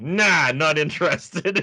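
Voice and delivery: lightly voiced, drawn-out brush-off; imitated dismissal. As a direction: imitate him waving it away, stretched out. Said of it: "So he does that".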